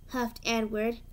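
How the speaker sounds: plain reading voice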